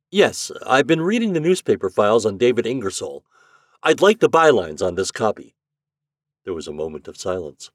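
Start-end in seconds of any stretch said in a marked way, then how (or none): 0.1-5.5 a brisk, businesslike man, higher and louder; crisp and direct, giving orders to a machine; Shandor's voice. A narrator's reading. Shandor asks the library index for files, brisk.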